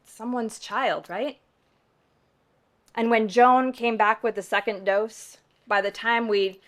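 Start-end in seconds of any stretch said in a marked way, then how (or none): none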